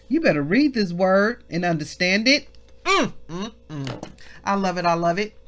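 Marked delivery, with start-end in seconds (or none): none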